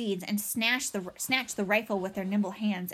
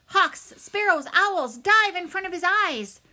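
hooted orders; 9 semitones higher, 7 dB louder than usual. A narrator's reading. Owl commander sending birds to distract the robot, hooted orders. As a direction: call out commands from above. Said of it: "hooted owls' orders from above".

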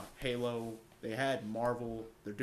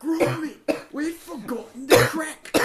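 high, loud mock British Wallace and Gromit voice; hammed-up British Wallace impression; British accent; 16 dB louder, slower than usual. Mocking a TikTok guy faking British, loud and high. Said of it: "talk like a British person".

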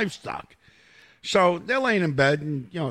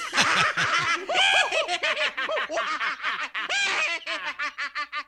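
howling laugh. Real, not acted. Host cracking up at his own joke, howling laugh. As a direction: let a big laugh burst out.

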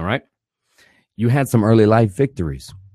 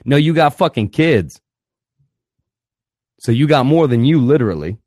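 ordinary talk

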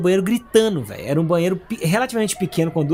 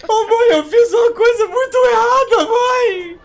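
high, loud, tearful pleading; crying desperately; 5 dB louder, much slower than usual. Retold or imitated. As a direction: wail to mom like a drunk kid in tears. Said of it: "chorando, desesperado já".

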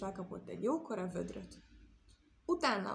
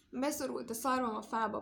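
complaining; 3 semitones higher than usual. Acted.

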